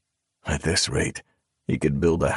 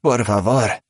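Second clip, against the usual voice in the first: higher, louder frantic begging; desperate pleading; 6 semitones higher, 4 dB louder than usual. Acted. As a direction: beg for your life. Trembling captive begs.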